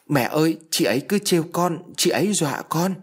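put-on a small boy complaining through tears; whimpering child. A narrator's reading. Little son telling mother he's being teased, mock sobbing. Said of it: "khóc mếu máo".

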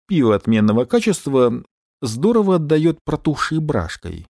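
plain reading voice

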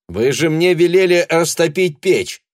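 high, loud protest; calling back. Acted. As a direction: shout back across rooms, put out. Shouting back from another room.

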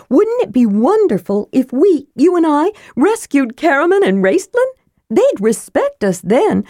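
high, lively persuading character voice; eager kender. A narrator's reading. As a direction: talk a friend into a rescue, building enthusiasm.

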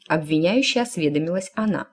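ordinary delivery

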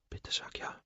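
breathless whispered plea; a pleading whisper to himself. A narrator's reading. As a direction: beg under your breath. Desperate inner plea.

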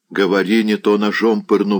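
ordinary delivery, nothing marked

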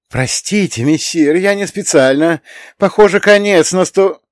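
high, rising fearful voice; panicky apology. A narrator's reading. Frightened cat apologising to his master, rising panic. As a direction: plead pardon with panic climbing.

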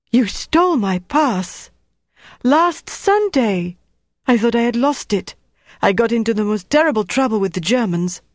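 a frightened cleaner realising she was robbed; Antoinette's alarmed outburst. A narrator's reading. Antoinette to Flick, realising her pass was stolen: high alarmed cry.

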